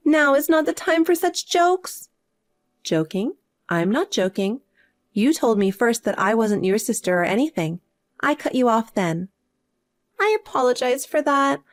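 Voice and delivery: usual speaking voice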